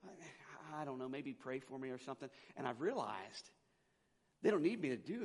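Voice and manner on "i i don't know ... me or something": soft, halting, unsure mumble; stammering. Retold or imitated. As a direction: imitate someone fumbling for an answer, quietly. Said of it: "people kinda stammer around".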